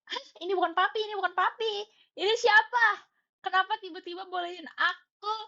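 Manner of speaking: high, loud, incredulous outburst; astonished squealed questions; retold shock. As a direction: cry out in acted disbelief. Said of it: "aku, aku terkejut, sumpah".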